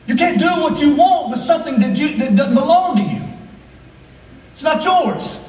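high, driving preacher cadence; full-force preaching. Acted. Pastor urging the church about the body, preaching at full force. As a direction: pastor pressing the congregation, preaching hard.

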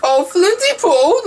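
high, slow fortune teller voice. Acted. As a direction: coo it like a mystic fortune teller.

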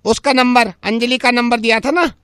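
Angelica. Acted. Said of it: "Angelica's coming".

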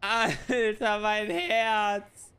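adrenaline shakes. Real, not acted. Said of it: "Das hab ich halt gar nicht mitbekommen".

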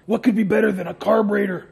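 usual speaking voice

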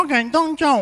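high, loud nasal goodbye; comic honking farewell; nasal speech impediment. Acted. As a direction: sign off through the nose, loudly. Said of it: "Agora vai falar fanhoso".

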